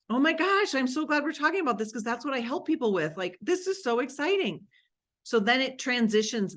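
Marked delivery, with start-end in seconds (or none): 0.1-3.2 higher and fast, delighted gush; excited demo reply. Shown as a demonstration. Coach acting her reply to a real estate agent, excited. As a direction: burst out with delight. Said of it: "And they were telling me".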